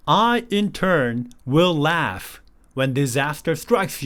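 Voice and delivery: slow, deliberate recitation of the verse; slow English Bible reading. Shown as a demonstration. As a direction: read the verse word by word for learners. Teacher reads the verse very slowly.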